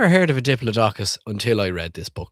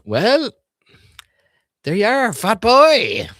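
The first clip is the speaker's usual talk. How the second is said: high, loud mock predator gloating; menacing dinosaur voice; 11 semitones higher, 8 dB louder, slower than usual. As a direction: gloat over the snack like a hungry dinosaur. Dinosaur voiced greeting its snack.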